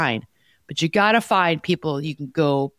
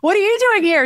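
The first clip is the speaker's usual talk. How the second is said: high, loud delighted surprise; surprised friend; 6 dB louder than usual. Retold or imitated. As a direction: squeal at an unexpected friend. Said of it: "She's like".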